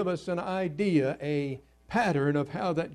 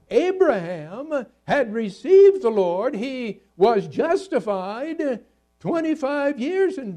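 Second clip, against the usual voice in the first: higher-pitched, insistent sermon voice; emphatic preaching; 6 semitones higher, 4 dB louder than usual. Real, not acted. Preacher likening water baptism to circumcision, emphatic preaching. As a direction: press the doctrine home from the pulpit.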